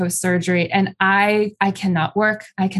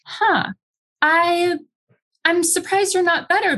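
lightly imitated, higher-pitched surprise; a mock "huh" of puzzlement, pitched up and halting; puzzled surgeon; 9 semitones higher than usual. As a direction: imitate a doctor mildly taken aback. Retold surgeon puzzled at the follow-up.

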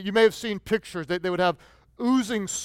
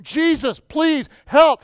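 1 desperate cry; 7 semitones higher, 6 dB louder, slower than usual. Acted. Lepers begging Jesus.

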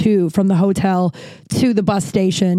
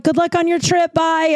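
cheerful goodbye; 8 semitones higher than usual. Retold or imitated. Stranger cheerfully sends her off.

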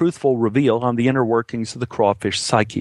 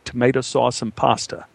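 plain reading voice

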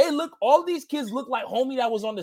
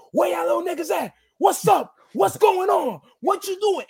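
trash-talk yell; 6 semitones higher than usual. Retold or imitated. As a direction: taunt the kids, yelling high. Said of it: "he's talking shit like".

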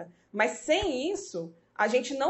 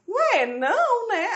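puzzled husband; 10 semitones higher, 5 dB louder, much slower than usual. Retold or imitated.

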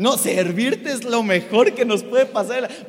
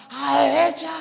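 mock lifeguard shout; 4 semitones higher, 4 dB louder than usual. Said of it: "el guardacostas gritaba".

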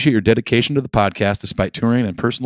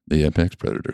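softer, lower documentary narrator voice; nature documentary; 5 semitones lower, 5 dB quieter than usual. Retold or imitated. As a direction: murmur it like a wildlife film.

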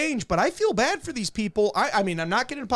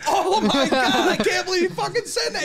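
shocked disbelief; 9 semitones higher than usual. Retold or imitated. Retelling his shock at the breakfast joke, high. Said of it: "And I was like".

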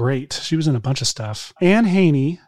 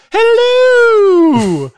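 very high, loud nanny hello; goofy dad doing a falsetto nanny greeting; Mrs. Doubtfire voice; 9 dB louder than usual. Shown as a demonstration. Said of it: "flawless Missus Doubtfire impression".

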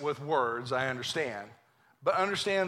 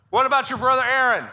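much louder and higher, pressing the point; God pressing Moses, louder and higher; emphatic repeat; 8 semitones higher, 11 dB louder, faster than usual. Acted. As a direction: hit it home the second time. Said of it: "he says".

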